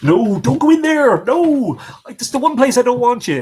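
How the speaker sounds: high, loud telling-off; real alarmed scolding. Real, not acted. Owner stopping the dog going in there, alarmed shout. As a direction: call the dog back urgently.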